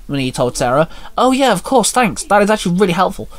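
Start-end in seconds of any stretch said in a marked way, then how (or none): 1.2-3.3 chirpy receptionist. A narrator's reading. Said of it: "Sarah said".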